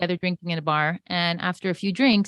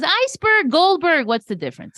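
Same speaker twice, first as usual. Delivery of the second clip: high, dismissive punchline; 7 semitones higher than usual. Retold or imitated. Joke teller landing the punchline in a high, dismissive voice. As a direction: toss the line off with a shrug. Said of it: "He goes".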